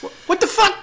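high-pitched indignant yelp; startled high what-the; 1 shocked protest. Real, not acted. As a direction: squeak it out, caught off guard. Mock outrage at being called awkward.

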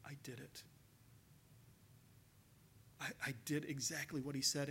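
very soft, clipped-off words of shame; hushed, stunned confession; bitten-off whisper of regret. Retold or imitated. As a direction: bite the words off quietly, as if caught. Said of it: "Peter biting off those words".

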